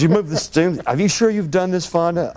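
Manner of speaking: high, teasing retold voice; mocking friend. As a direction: needle him about being a novice. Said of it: "He says".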